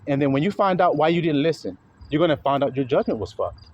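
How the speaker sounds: ordinary talk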